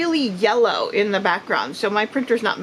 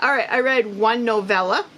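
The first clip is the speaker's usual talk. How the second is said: usual speaking voice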